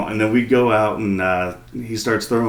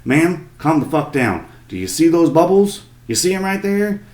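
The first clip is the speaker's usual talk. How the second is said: higher, urgent guide pointing out bubbles; a hint of a gruff guide; lightly voiced boat guide; 6 semitones higher than usual. Boat guy explaining to the mother, lightly voiced urgent. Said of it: "and he's like".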